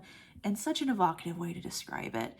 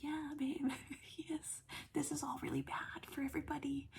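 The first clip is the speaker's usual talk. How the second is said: high, soft, hushed commiseration; gentle sympathy; 8 semitones higher, 9 dB quieter than usual. Acted. Reviewer consoling a film character, gently sympathetic. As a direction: coo it softly with a little laugh.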